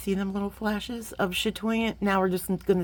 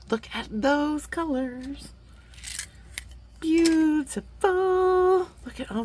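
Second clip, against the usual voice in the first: awed; 8 semitones higher, slower than usual. Real, not acted. Admiring beads.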